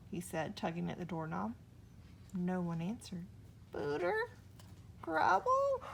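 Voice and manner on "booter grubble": high worried calling of names; anxious call. A narrator's reading. Hugly alone in the dark room, high anxious call. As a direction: call out, unsure.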